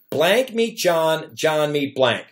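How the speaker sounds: each word laid out slowly; slow dictation of a fill-in-the-blank line; slow template read. Shown as a demonstration. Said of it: "the template's gonna go".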